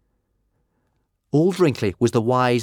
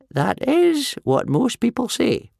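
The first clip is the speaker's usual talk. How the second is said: put-on old man voice; 4 dB louder, slower than usual. Wrinkly's old voice.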